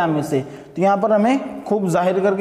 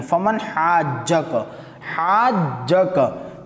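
very slow, stretched melodic recitation; slow Quranic chant with long vowels; 3 semitones lower, much slower than usual. Shown as a demonstration. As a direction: draw out every syllable like tilawat. Reciting Quran verse in class.

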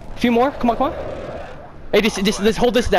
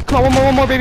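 excited, urging; 5 semitones higher, 5 dB louder, much faster than usual. Real, not acted. Urging final kill.

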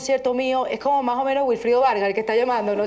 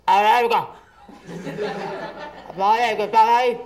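Carlos Croes; 2 semitones lower than usual. Acted. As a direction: mimic someone leaving a phone message, projecting hard. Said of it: "habla como Carlos Croes".

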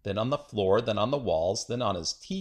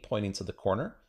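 ordinary delivery

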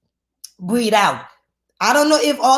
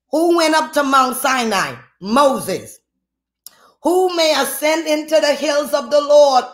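raised, ringing sermon voice; fervent scripture preaching; 4 semitones higher than usual. Real, not acted. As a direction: declaim the verse to the congregation.

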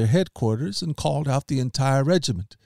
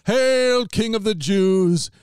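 much higher, slow mocking hail; sneering salute; taunting; 9 semitones higher, slower than usual. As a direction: soldiers mocking Jesus, higher. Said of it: "taunted him".

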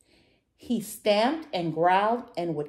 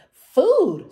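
loud, high storybook exclamation; high-pitched, hammed-up yell; excited hungry shout; 12 semitones higher, 12 dB louder than usual. A narrator's reading. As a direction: burst out greedily at the sight of a meal. Hungry dinosaur spots food.

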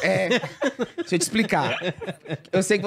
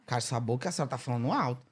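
hushed scolding; 7 semitones lower, 7 dB quieter than usual. Retold or imitated.

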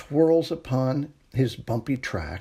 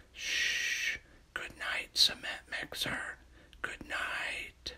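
soft bedtime whisper, almost no voice; 10 dB quieter than usual. Acted. Reader whispering goodnight to Crane Truck at bedtime, whispered. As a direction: hush it like a child is asleep.

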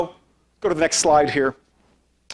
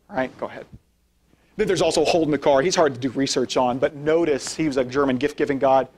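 ordinary talk, nothing marked

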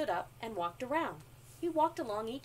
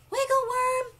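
high, loud squeaky call; squeaky little creature calling a name; 14 semitones higher, 10 dB louder than usual. A narrator's reading. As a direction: call out like a tiny ant. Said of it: "squeaky voice".

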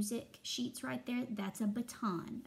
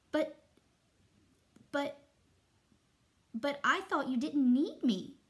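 higher, halting piccolo squeak; squeaky little voice; 4 semitones higher, 6 dB louder than usual. A narrator's reading. Piccolo explains hiding to the conductor, squeaking. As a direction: stammer it out, small and high. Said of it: "she squeaked".

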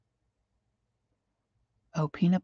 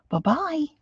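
sing-song bye; 10 semitones higher than usual. Real, not acted.

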